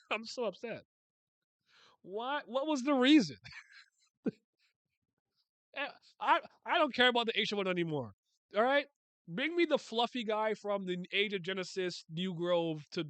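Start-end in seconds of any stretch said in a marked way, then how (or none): 0.0-0.8 laughing while saying it, higher; giggling through the complaint. Real, not acted. Reacting to an anime scene, laughing at his own anger. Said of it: "I don't know why that made me angry". 2.0-11.3 excited rant. Real, not acted. Ranting about Rak in a scene.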